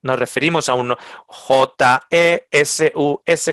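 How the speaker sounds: J E S U S spelled letter by letter, slowly. Shown as a demonstration. Preacher spells the name for the congregation, letter by letter. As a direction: spell J E S U S one letter at a time. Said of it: "con acento en la U".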